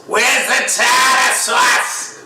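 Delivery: put-on shrieking Boston-accented seagull, high and drawn out. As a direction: squawk it like a gull with a Boston accent. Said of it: "they talk with Boston accents".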